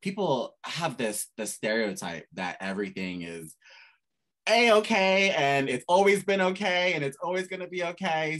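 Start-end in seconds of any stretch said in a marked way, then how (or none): 4.5-8.4 higher, louder sing-song "A-okay"; mock cheerful. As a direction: parody a cheery everything-is-fine voice. Mocking the stereotype.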